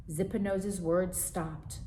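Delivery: plain reading voice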